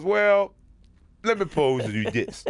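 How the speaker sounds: high, loud, hammed-up interviewee voice; mock tough-guy guest. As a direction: puff up like a guest lecturing the host. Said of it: "He goes".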